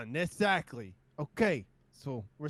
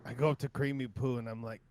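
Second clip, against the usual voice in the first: usual speaking voice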